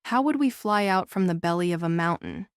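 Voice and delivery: plain reading voice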